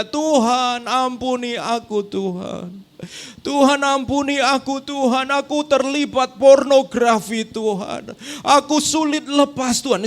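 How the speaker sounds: loud, high pleading to God; pleading cry. Retold or imitated. Preacher retelling friend's confession, loud high plea. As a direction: cry out for forgiveness, imitating the friend. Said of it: "muncul dari suara dari mulutnya".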